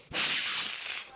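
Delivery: sharp voiceless hissing noise; snake hiss. Retold or imitated. Snake jumping up at the camera click, imitated hiss.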